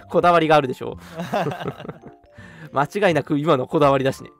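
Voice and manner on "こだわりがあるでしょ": ordinary talk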